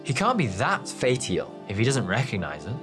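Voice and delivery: quick, doubtful reasoning; unconvinced, hurried. A narrator's reading. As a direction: question it with mild scepticism. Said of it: "Nesteri said doubtfully".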